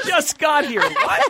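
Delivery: high-pitched, mock-innocent reply; mock newcomer. Newcomer answering puzzled housemates, mock innocent.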